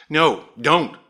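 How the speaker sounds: sharp. A narrator's reading. Wife stops husband going out to the car, sharply. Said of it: "said Grace as before".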